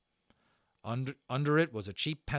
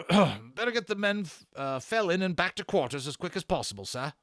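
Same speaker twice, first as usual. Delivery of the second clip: high, loud corporal voice, eager to please; a corporal briskly urging his officer to dismiss the men; soldier reporting; 7 semitones higher, 7 dB louder than usual. Acted. Soldier Fickets voiced as figure.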